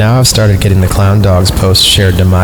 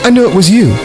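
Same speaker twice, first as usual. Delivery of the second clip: accusing; 11 semitones higher, faster than usual. Acted. Girl voices her angry message.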